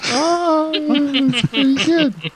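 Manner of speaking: put-on high giggly child; laughing child.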